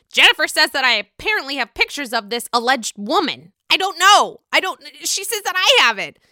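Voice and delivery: loud, high mock indignation; exasperated retold protest. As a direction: act out his worked-up denial. Retelling husband's heated reunion denial.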